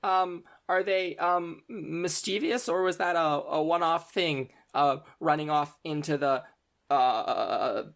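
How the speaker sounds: high, halting, slow nervous voice; flustered stammer. Acted. Flustered student asking about the cat incident. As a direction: hunt for each word anxiously. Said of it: "she seems very flustered".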